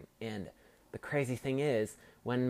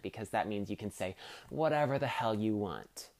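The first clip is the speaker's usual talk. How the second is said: usual speaking voice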